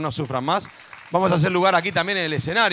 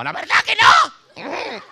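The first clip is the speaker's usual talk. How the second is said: mock teacher's yell, high and loud; gritona; 10 semitones higher, 6 dB louder, much slower than usual. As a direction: suddenly shout it as a comic demonstration. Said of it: "no es que esté gritando todo el tiempo". (grito agudo imitando a una maestra gritona)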